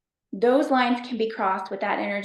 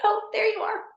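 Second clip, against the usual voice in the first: much higher, a delighted gasp of recognition; delighted discovery; 12 semitones higher than usual. Real, not acted. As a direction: greet the cat like a long-lost friend. Meeting her cat in van.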